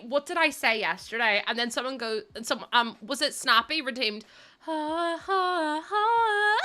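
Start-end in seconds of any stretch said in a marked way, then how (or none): 4.6-6.7 rising peals of laughter; real laughter. Real, not acted. Streamer cracking up at a clip. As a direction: laugh louder and higher.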